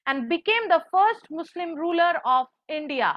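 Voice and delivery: slow, careful dictation. Shown as a demonstration. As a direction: dictate it word by word. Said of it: "আমি পুরোটা বলে দিই একবার".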